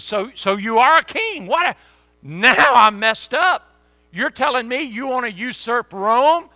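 loud, high, rattled Pilate; freaked-out Pilate. Acted. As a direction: blurt it out like a panicked governor.

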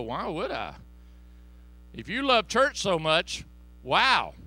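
loud sneering dismissal; mock coworker, loud and scornful; scoffing coworker. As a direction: scoff at the invitation. Coworker mocking churchgoer.

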